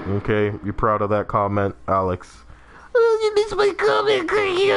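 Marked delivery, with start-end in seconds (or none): 2.9-4.7 very high falsetto; mock whiny viewer. Streamer impersonating a chat member's complaint, silly falsetto. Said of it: "I love my impressions of you guys".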